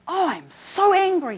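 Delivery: hammed-up furious young man; imitating an angry patient. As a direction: act out his fury, drawing it out. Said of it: "absolutely furious, fuming".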